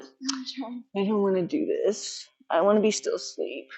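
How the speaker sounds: very soft, drawn-out sleepy whine; mock sleepy reluctance. Trainer mimicking the student who is quitting, sleepy and reluctant. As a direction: imitate a half-asleep quitter, barely audible and dragging. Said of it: "You're just like".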